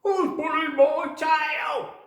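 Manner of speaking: high, loud, slow indignant squawk; angry parrot shout. Acted.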